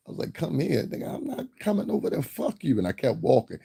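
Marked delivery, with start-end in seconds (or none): 0.3-2.8 high, incredulous refusal; mock-indignant comeback, pitched up; defiant retort. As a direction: brush the caller off in a high voice. Retold defiant reply to the caller.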